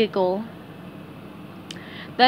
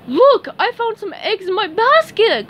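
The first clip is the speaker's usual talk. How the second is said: high, loud delighted cry; happy Patrick; 11 semitones higher, 7 dB louder than usual. A narrator's reading. Patrick finds eggs in his basket, crying happily. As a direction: exclaim it with childlike glee. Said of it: "cried Patrick happily".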